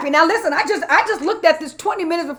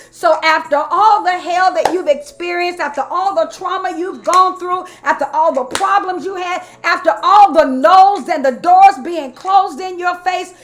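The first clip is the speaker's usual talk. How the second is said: higher, rolling preacher declarations, building to a loud peak; fervent preaching; 4 semitones higher, 4 dB louder than usual. Real, not acted. Preacher declaring affirmations after trauma, fervent and building. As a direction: pile up the phrases like a sermon climax.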